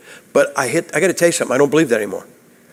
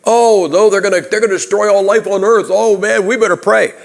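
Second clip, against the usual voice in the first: mock panicked moviegoer; 6 semitones higher than usual. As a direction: play a scared movie character crying out to God. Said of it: "what did people do in the movie?".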